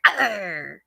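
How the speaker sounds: mock pirate grumble, tired; playful pirate snarl; grouchy pirate growl. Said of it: "and I'm like".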